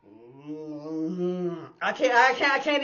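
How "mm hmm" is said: low moaning mm-hmm groan; a low guttural moan-groan; imitated groan. Imitating the big man's lustful moan on the bus. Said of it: "He would go".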